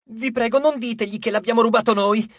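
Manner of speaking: high, pleading dwarf voice; beg, voice up high. A narrator's reading. Dwarf begs the heroes not to tell, high and pleading. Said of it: "supplicò Passalo".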